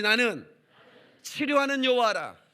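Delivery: loud call-and-response recital; congregation repeats. Shown as a demonstration. Preacher leading the congregation in unison recital. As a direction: lead the crowd in reciting the verse. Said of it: "다 같이".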